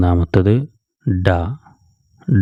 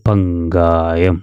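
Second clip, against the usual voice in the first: പങ്കായം stretched slowly with a clear ങ്ക; very slow careful reading; much slower than usual. Shown as a demonstration. Teacher points out the ങ്ക letter, reading slowly. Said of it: "ങ്ക എന്നുള്ള അക്ഷരമാണ് ശ്രദ്ധിക്കേണ്ടത്".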